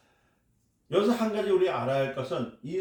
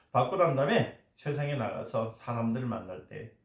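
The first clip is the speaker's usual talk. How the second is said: normal talking voice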